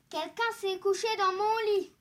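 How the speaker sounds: big bear shout. A narrator's reading. Big bear finding his bed used, loud outcry. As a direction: cry out loudly as an indignant big bear. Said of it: "s'écria de sa grosse voix".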